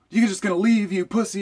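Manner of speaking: high, loud taunting scream. Retold or imitated. Driver screaming at him as he leaves, high taunting yell. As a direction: shriek the insult after him.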